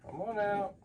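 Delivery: high, soft coaxing; coaxing pet voice. Real, not acted. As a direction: sweet-talk a shy animal out. Coaxing a snake out of cage.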